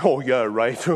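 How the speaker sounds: put-on low, doubting teenage scoff; Andrew. Teenage son answering dad's offer, mock disbelief. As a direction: imitate a son's sceptical comeback. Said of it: "To which Andrew said".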